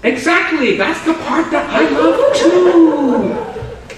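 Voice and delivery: high, slow laughing tease; teasing laugh. Pretend. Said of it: "I was just teasing you".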